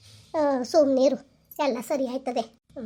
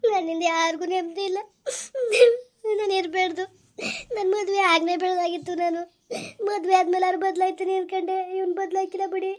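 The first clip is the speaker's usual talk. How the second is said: tearful, high-pitched despair; weeping to grandmother, voice pitched up; crying; 5 semitones higher than usual. Acted. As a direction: sob through the self-blame. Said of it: "ಅಳ್ಬೇಡ, ಸುಮ್ನಿರು".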